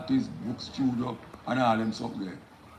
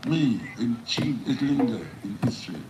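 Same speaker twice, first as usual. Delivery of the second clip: emphatic spoken-word delivery, phrases falling at the ends; poem recitation; 5 dB louder than usual. Acted. Poet performing the poem live for an audience, declaimed. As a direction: declaim each line with weight, pausing between phrases.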